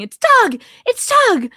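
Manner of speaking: high, loud excited shout; imitated scream. Host imitating Jay spotting Doug on stream, screaming. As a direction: yell it out like a mock alarm. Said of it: "Jay was screaming".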